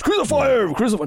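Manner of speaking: a hammed-up shout of crucify; mock crowd shout. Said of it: "He goes".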